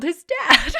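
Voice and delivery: words breaking up into laughter; can't say it without laughing. Real, not acted. Host baffled by a character hating fire, laughing. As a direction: crack up on the way through. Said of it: "I can't say it without laughing".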